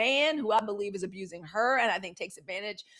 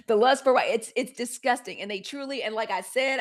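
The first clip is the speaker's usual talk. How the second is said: normal talking voice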